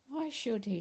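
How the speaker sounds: a quick narrated snap; snapped. A narrator's reading. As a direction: bite the words off, defending his friend. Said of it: "snapped X-Ray".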